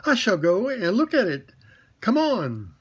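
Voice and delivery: put-on brave, matter-of-fact young boy; Christopher Robin. A narrator's reading. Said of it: "said Christopher Robin".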